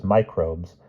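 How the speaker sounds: ordinary delivery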